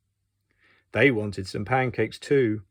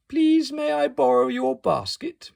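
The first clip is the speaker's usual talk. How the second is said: much higher, sweet polite request; asking very nicely; 13 semitones higher, 3 dB louder than usual. Acted. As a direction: ask as sweetly as you can. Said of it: "he asked very nicely".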